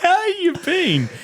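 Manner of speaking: squealy high, slow mimicry; hammed-up awkward hello; mocking greeting. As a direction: drag it out way up high, nearly laughing. Mimicking the awkward opener, amid laughter.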